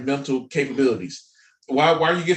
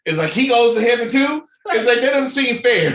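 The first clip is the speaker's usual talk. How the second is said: higher, louder indignation; indignant rant; 9 semitones higher, 5 dB louder than usual. Real, not acted. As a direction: rant about the injustice. Ranting about cartoon fairness.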